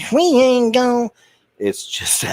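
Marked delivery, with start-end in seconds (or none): 0.1-1.1 hammed-up Southern accent, much higher and slow; exaggerated Southern accent, pitched way up, drawn out; mock Southern accent. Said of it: "her using her accent in front of a Southern crowd".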